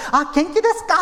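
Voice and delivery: high, bright Japanese greeting. Retold or imitated. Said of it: "하면 되는 거예요".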